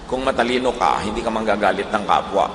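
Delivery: ordinary talk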